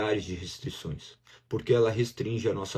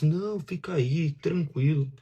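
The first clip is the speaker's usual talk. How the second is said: slow, higher, honeyed "Não, fica aí"; sweet voice; 6 semitones higher, slower than usual. Acted. Preacher voicing the sweet-seeming voice, slow and honeyed. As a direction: coax gently like a sweet tempter. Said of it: "aquela voz que aparenta ser muito doce".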